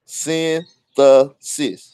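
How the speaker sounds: very slow, careful "Synthesis", every syllable drawn out; painstakingly slow "Synthesis"; said slowly. Shown as a demonstration. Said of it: "if I do it slow, I can do it".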